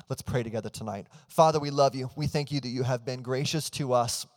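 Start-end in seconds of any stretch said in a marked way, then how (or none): 1.3-4.2 louder, fervent pastor's prayer; earnest spoken prayer that opens with a loud Father; congregational prayer. Real, not acted. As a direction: pray aloud over the room with rising fervor. Leads the church in prayer.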